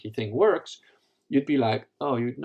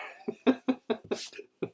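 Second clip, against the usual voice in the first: high, helpless laughter; wheezy burst of laughing; amused laugh; 14 semitones higher than usual. Real, not acted. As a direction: crack up with real amusement. Cracks up at comment's mistake.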